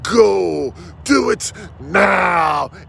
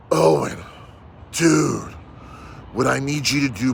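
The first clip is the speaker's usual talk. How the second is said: normal talking voice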